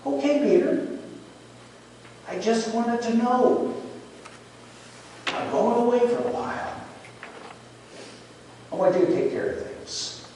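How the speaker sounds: high, soft voice, settling down; gentle reassurance. Retold or imitated. Jesus entrusting Peter before leaving, soft and gentle.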